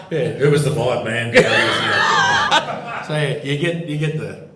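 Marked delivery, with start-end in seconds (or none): 1.4-3.0 loud laugh. Real, not acted. Laughing at a colleague's joke.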